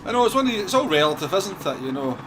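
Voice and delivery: usual speaking voice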